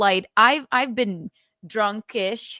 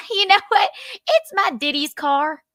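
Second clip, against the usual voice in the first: put-on Southern accent; 12 semitones higher, 4 dB louder than usual. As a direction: sweet-talk a cop in a hammed-up Southern accent. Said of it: "I put on my Southern accent".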